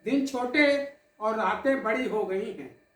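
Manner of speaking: measured, dramatic poetic recital with held pauses; poem recitation. Acted. Poet recites tribute to the audience, in recital cadence. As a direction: recite it like a poet at a memorial gathering.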